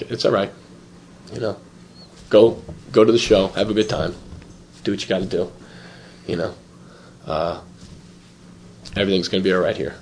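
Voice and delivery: low, soft voice with long pauses; subdued, halting reassurance; quiet resigned father. Retold or imitated. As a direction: imitate a father quietly letting it go. Father quietly lets him go.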